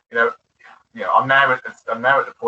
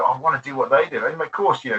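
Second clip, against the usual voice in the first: ordinary talk, nothing marked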